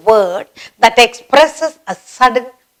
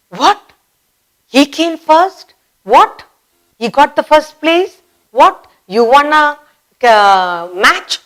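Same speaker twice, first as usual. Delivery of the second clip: higher, disbelieving questions; shocked surprise; 6 semitones higher than usual. Shown as a demonstration. Teacher demonstrating shock at surprising news. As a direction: sound amazed at the news. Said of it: "You will say".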